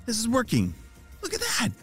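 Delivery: rising to a high, thrilled cheer; excited discovery. Acted. Jaden sees his plan working.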